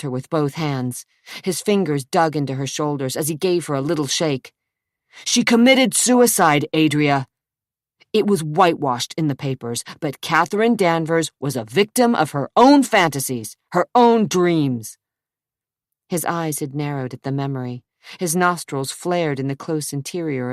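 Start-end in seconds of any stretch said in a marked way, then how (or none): 5.2-15.0 loud, forceful and hard; angry insistence. Acted. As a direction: drive it home furiously. He shakes her, insists.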